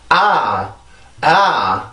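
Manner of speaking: 아 drawn out and gliding, without throat tension; sound merely rising. Shown as a demonstration. Teacher showing the mistake, loose drawn-out 아. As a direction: let 아 stretch and glide loosely. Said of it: "내가 소리로 올라가는 게 아니죠".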